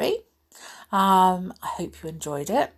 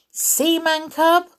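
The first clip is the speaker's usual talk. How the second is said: teasing monkey; 10 semitones higher, 5 dB louder, slower than usual. Acted.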